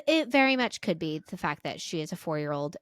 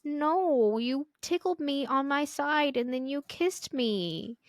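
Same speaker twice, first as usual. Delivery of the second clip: higher, slow, lightly played small girl insisting; insistent little daughter; 8 semitones higher, slower than usual. Mom imitating her daughter insisting, higher and slow. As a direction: mimic a four-year-old insisting. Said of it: "And she's like".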